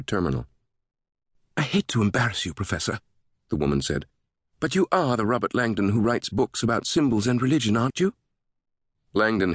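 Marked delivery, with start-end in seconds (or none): 1.6-3.0 higher, lilting British voice; British greeter broaching something awkward; polite British lilt, pitched up; sing-song British woman. A narrator's reading. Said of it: "a sing-song British voice". 4.6-8.1 higher British lilt, eager question; British fan checking who she's met; lilting British voice, pitched up; sing-song British woman. A narrator's reading. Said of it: "a sing-song British voice".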